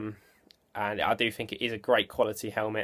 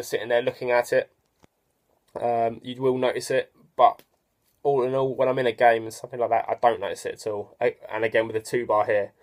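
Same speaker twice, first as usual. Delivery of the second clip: speaking through a facemask; wearing a football helmet. Real, not acted. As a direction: chin cup on jaw. Seen 1: Helmet with facemask and chin cup worn throughout; references bareheaded.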